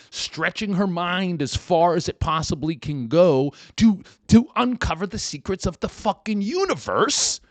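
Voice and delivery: loud, high angry rant. Real, not acted. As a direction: rant at the busybody. Ranting at small-minded neighbor.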